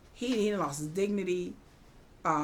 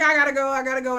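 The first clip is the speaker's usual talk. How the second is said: higher, louder repeated excuse; mock hurried escape; 5 semitones higher, 8 dB louder, much faster than usual. Suitor fleeing Ida, mock urgent. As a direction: imitate someone desperate to get out.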